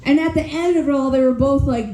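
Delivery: ordinary talk, nothing marked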